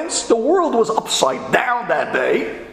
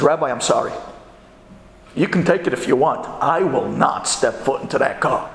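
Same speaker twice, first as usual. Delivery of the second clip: low, stubborn apology; firm refusal; 8 semitones lower than usual. Retold or imitated. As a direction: dig in and refuse in a lowered voice. Partner flatly refuses the car.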